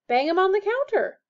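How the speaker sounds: brisk witch at the counter; witch's voice. A narrator's reading.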